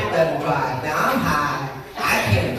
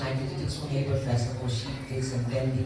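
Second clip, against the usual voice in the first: normal talking voice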